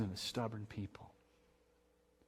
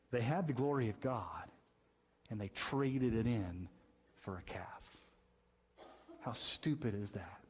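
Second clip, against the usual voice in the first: normal talking voice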